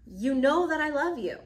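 insist, a little put out; exasperated insistence. Acted. Peter answers Jesus's repeated question, exasperated.

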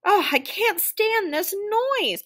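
slow, high-pitched protest; exasperated complaint. A narrator's reading. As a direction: wail it in exasperation, drawn out. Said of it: "muttered Mister Winky".

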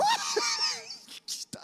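high-pitched obnoxious cackle; obnoxious laugh. Retold or imitated. Preacher re-enacts laughing at his classmate's mockery, high obnoxious laugh. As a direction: burst into a mock obnoxious laugh. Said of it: "I was laughing obnoxiously".

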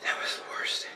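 breathless pained whisper. Real, not acted. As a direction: whisper it, drained by the burn. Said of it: "I'm crying".

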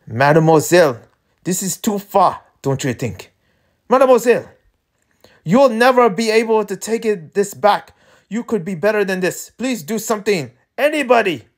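high, urgent character plea; desperate pleading. Acted. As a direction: beg them to stop, voice climbing. Walled-in character pleads for help.

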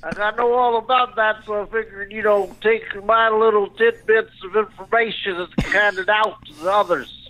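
high, put-on celebrity voice; self-satisfied lecturer, pitched high; Bill Cosby. Comic as Bill explains his class, high-pitched. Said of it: "here, Bill, take the phone".

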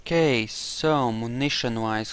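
ordinary delivery

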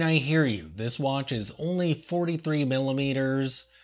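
usual speaking voice

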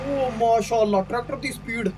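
ordinary talk, nothing marked

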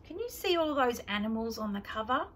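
ordinary delivery

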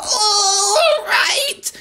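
very high, loud indignant yell. A narrator's reading. Grandma yelling back at George. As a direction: throw it back furiously. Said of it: "she yelled".

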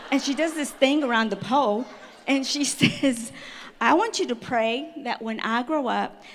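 can't hold back the laughter while telling it; laughing. Real, not acted. Laughing retelling the pole dance.

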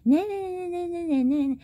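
high, mocking sing-song nah; imitating the girl yelling back. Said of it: "popping off at the mouth, running".

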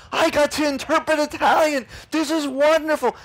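overjoyed, beaming with wonder; thrilled. Retold or imitated. The cowboy interpreter delighted at his gift, thrilled high gush.

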